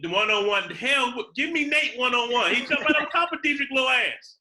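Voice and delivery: high, loud excited banter; worked-up trash talk; animated boasting. Real, not acted. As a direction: burst in loud and high, arguing your pick. Heated banter over one-on-one matchup.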